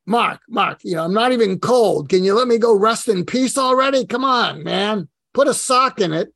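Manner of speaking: put-on loud, high exasperated Brooklyn accent; dead mentor shooing him away, thick Brooklyn accent; loud, fed-up Brooklyn accent. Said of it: "thick Brooklyn accent".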